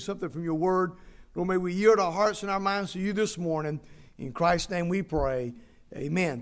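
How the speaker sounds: hushed church prayer, said gently; soft pastoral prayer. Acted. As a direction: pray it softly over the congregation. Leads opening prayer.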